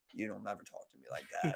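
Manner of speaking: low, hushed warning; quiet menace. Retold or imitated. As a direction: imitate a big man's deadly quiet threat. Same warning retold again.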